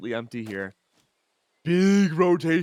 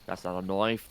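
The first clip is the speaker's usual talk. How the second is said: put-on low, softer parody Australian accent; mock Australian; 7 semitones lower, 5 dB quieter than usual. Caster riffing on a film line, low mock Australian accent. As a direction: lower mock Aussie setup line, rising end. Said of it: "be Aussie for a round".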